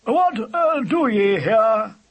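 put-on loud, high, slow old-fashioned demand; grunting badger. A narrator's reading. Badger challenging intruders at his hole, grunted. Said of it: "grunted the badger".

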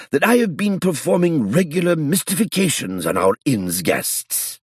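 high, loud flustered denial; old man crying out in shock; alarmed protest. A narrator's reading.

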